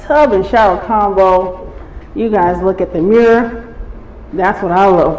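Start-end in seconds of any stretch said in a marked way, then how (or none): none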